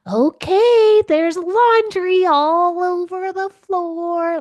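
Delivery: high, loud, slow silly put-on voice; funny voice. Shown as a demonstration. Mom teasing her teenager about laundry in a silly high voice. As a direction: ham it up to tease your teenager. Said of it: "funny voices".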